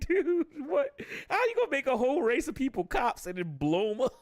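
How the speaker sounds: high, baffled outburst; can't believe what he's hearing; stunned disbelief. Retold or imitated. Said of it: "I'm just like".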